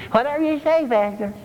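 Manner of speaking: mocking the wimps who just go along; mock passive agreement. Mocking passive congregation members.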